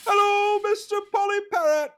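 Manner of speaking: very high-pitched British call to a parrot; hammed-up sing-out wake-up call. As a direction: holler high to wake a sleeping bird. Customer trying to wake the parrot.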